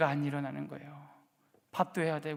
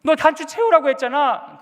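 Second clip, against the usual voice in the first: scolding mother; 7 semitones higher, 10 dB louder than usual. Retold or imitated. Mother scolding slow child.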